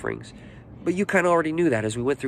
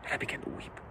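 almost whispered, overcome; very soft, moved confession; hushed emotion; 16 dB quieter than usual. Real, not acted. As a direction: let the voice drop away to a breath. Moved recalling his weeping.

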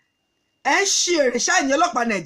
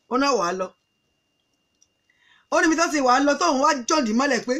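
usual speaking voice

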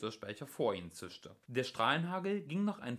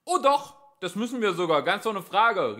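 high, loud confirmation; emphatic police holler; shouting back; 9 semitones higher, 11 dB louder than usual. A narrator's reading. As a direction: back your colleague up at full volume. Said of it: "rief der andere Polizist zurück".

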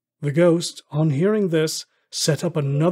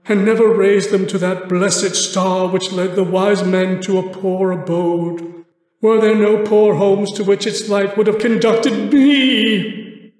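loud, raised voice of a remorseful ghost; lamenting ghost; 4 semitones higher, 6 dB louder, slower than usual. A narrator's reading. Marley's ghost mourning his misspent life, loud and grieving. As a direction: mourn each wasted chance, slowing at the end.

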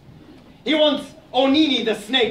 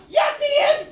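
squeaky hedgehog. Acted. Hedgehog argues with Aso, a high indignant squeak. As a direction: protest in a tiny outraged voice.

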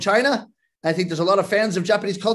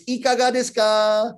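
sing-out street promoter's pitch, held on one note; chanted sales call; tissue hander's call; 3 semitones higher than usual. Acted. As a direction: call it out to passers-by, stretching the end. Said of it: "word which accompanies the handing of the tissue".

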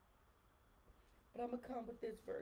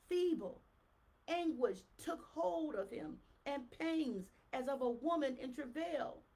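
loud, slow, rising proclamation; fiery preaching; 3 semitones higher, 5 dB louder, slower than usual. Acted. Preacher roaring Jeremiah's prophecy to the church, loud and slow. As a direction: thunder the prophecy at them.

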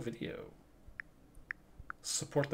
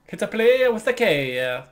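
high, slow exaggerated Italian accent; 11 semitones higher, 11 dB louder, slower than usual. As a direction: sing it out in a hammy Italian accent. Mimicking Italian phrase, playful.

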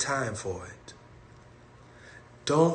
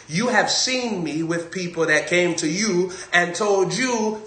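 normal talking voice

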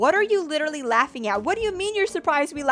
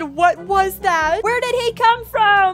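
slow, stretched mock scream, climbing higher; 7 semitones higher, 5 dB louder, slower than usual. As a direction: voice a friend's rising, loud wail. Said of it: "stop screaming in my ear".

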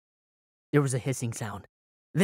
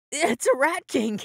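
panicked cry; 9 semitones higher than usual. Acted. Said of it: "panic at the monster".

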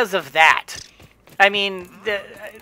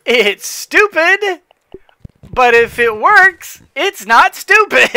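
excited; 8 semitones higher, 8 dB louder than usual. Real, not acted. Excited gamer triumph.

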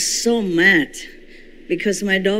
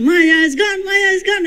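joyful crying out; 12 semitones higher than usual. Retold or imitated. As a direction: cry it out in jubilation. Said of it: "jumping and crying".

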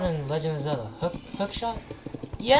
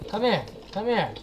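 ordinary delivery

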